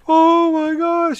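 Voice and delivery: high, loud, very slow drawn-out wail; imitating her crying. Girlfriend sees the red tub, imitated wailing. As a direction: stretch it into a mock bawling cry. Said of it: "She starts crying".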